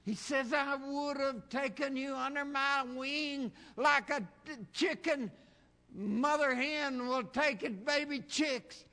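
high, loud, soaring sermon cry; preaching at full force. Acted. Preacher pleading with the congregation, preaching at full force. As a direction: preach it out, voice climbing and ringing.

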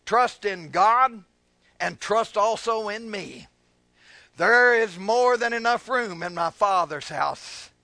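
slow, spaced, emphatic phrases; preached scripture reading. Real, not acted. Preacher reading Jesus' promise, slow and emphatic. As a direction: give each phrase room and weight. Reads scripture aloud to congregation.

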